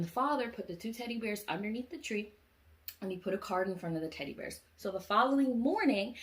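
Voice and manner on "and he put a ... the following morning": ordinary delivery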